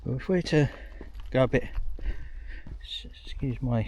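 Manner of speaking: breathless from the climb; winded, breathing hard. Real, not acted. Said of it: "feel a bit out of breath climbing up".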